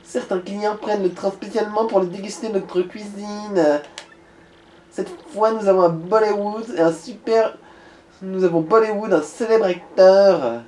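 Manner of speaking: put-on valley girl. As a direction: play the ditzy hostess. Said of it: "une voix de pétasse".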